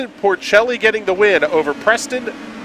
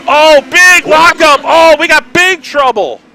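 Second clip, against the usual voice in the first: excited shout; 11 semitones higher, 9 dB louder than usual. Real, not acted. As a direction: yell out the crash as it happens. Commentator calls a crash.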